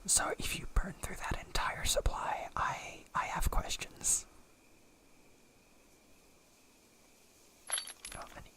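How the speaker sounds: breathy whisper right in your ear. Real, not acted. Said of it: "a cat boy whispering in your ear".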